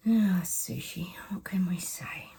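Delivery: a slow wry whisper to the viewers; whispered aside. Real, not acted. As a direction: whisper it, dry and knowing. Wry aside after reading.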